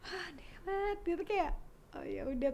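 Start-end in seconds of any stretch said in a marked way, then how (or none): 0.0-1.0 mock frustration. Acts out disappointment after refusal.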